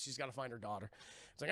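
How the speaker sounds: normal talking voice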